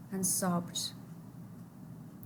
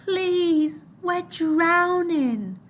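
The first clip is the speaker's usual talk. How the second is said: high, very slow desperate plea; high pleading cry; begging; 8 semitones higher, 4 dB louder, much slower than usual. Acted. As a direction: beg for your life. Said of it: "Mahmoud begged".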